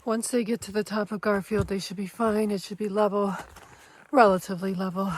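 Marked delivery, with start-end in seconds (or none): none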